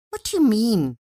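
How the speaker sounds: dismayed wife asking; taken aback. A narrator's reading. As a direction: caught off guard by his no.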